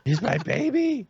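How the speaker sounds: put-on very high cooing baby talk; baby talk to dog. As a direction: squeal baby talk at the puppy. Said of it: "like I'm talking to a baby".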